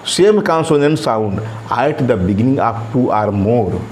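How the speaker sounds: slow, deliberate reading of the definition; slow classroom dictation. Shown as a demonstration. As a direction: read it out slowly for students to copy. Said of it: "teacher dictating slowly".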